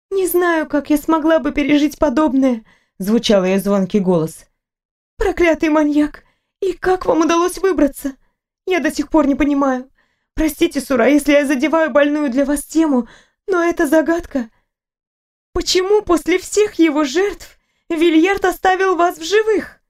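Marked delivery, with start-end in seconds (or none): 0.1-2.6 higher, brisk talkative nurse; a fussing nurse who cannot stop talking; chatty nurse. Acted. Nurse chatters to the waking patient, higher and fussy. 5.2-19.8 higher, brisk talkative nurse; a fussing nurse who cannot stop talking; chatty nurse. Acted. Nurse chatters to the waking patient, higher and fussy.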